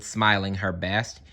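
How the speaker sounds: plain reading voice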